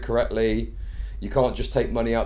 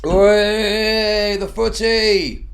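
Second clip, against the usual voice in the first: rowdy football lad; 10 semitones higher, 7 dB louder, much slower than usual. Pretend. Lad hollers about football.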